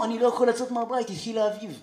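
a huge man whining about allergies; tough Russian friend. Retold or imitated. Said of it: "בן אדם סוס יקום".

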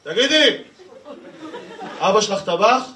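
high, brash call to a woman; mock yelled pickup line. Friend hollering a pickup line at a woman, mock yell. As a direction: imitate a cocky friend calling out. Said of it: "צועק עליה".

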